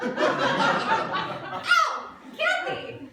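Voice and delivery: very high, loud wail of complaint; pained shout. Acted. Boyfriend in closet calling out to girlfriend, pained shout. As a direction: cry out the name as if hurt. Hidden boyfriend cries out in pain.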